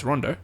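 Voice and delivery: "Rondo" as RUN-doh, short u sound; "Rondo": first syllable like "run", then "doh"; correct name. Shown as a demonstration. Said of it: "a clip of him saying his own name".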